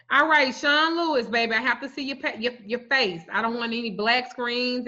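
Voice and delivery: usual speaking voice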